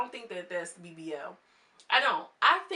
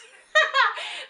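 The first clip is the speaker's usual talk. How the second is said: high shrieking laugh; burst of laughter; 13 dB louder than usual. Real, not acted. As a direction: crack up loudly. Cracks up at the comeback.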